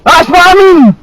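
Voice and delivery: real angry yell, pitched high; furious shout. Real, not acted. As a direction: shout it down at an opponent. Real street quarrel, shouting insults back.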